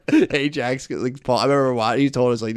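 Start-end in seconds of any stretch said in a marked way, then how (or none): none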